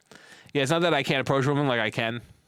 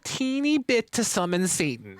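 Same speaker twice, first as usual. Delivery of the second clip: high, slow put-on pleading voice; mocking mimicry; 5 semitones higher than usual. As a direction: mimic a wheedling villain, high and drawn out. Mimicking the game mother's plea.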